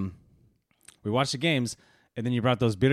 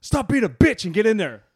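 self pep talk; 7 semitones higher than usual. Retold or imitated. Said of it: "that's what I said to myself".

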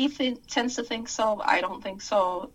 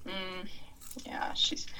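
whisper; 2 semitones lower, 9 dB quieter, slower than usual. Acted. Confiding aside about robot.

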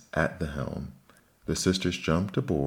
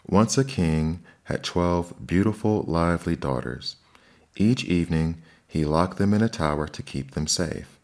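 plain reading voice

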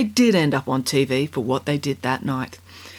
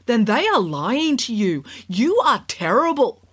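sarcastic scolding tv judge; 6 semitones higher than usual. A narrator's reading. Judge insults Kat.

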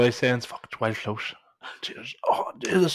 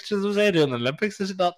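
puzzled workmate; 9 semitones higher than usual. Retold or imitated. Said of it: "He's like".

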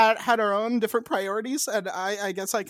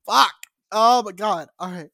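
ordinary talk

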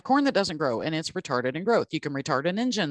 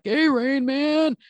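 put-on high, loud, slow sing-song jeer at the boy; mocking taunt; 9 semitones higher, 6 dB louder, much slower than usual. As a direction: taunt him like a bully kid on the bus. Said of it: "they were like".

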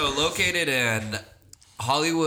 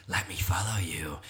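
whisper; 7 semitones lower, 6 dB quieter than usual. Retold or imitated. Mock stalker app quote.

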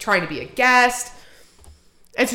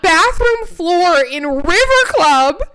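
slow, loud, rising comic horror; scandalized, drawn-out reveal; incredulous emphasis; 6 semitones higher, 4 dB louder, slower than usual. Real, not acted. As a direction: punch out each word, building louder and higher. Climax of shocking story reveal.

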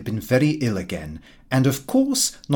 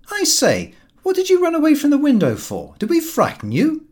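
put-on higher, louder teasing young man; cheeky young Lupin; 5 dB louder than usual. Son teasing his father at the door, high and loud. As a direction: tease your father for hiding.

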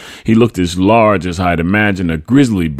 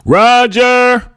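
high, loud call across a courtyard; 6 dB louder, much slower than usual. A narrator's reading. Mother calls her son in from the courtyard, calling out. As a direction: holler for someone far off. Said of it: "my mother's voice calling".

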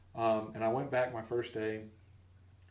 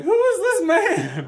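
very high, loud, slow, laughing disbelief; mock outrage; 13 dB louder, slower than usual. Relaxed brain reacting to the caller, laughing indignation. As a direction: gasp it in comic shock.

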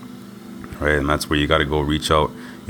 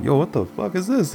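high, stunned outburst; shocked disbelief; 14 semitones higher than usual. Retold or imitated. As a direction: react in disbelief. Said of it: "And I'm like".

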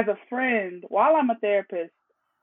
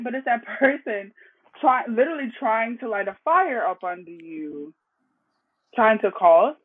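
ordinary talk, nothing marked

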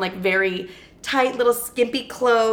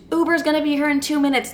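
mock yell, pitched well up; imitated yelling; 7 semitones higher than usual. Guys rushing the girls to the Uber, mock yelling. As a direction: imitate impatient guys calling out in a raised voice. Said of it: "They're yelling at us".

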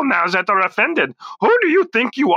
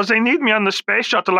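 usual speaking voice

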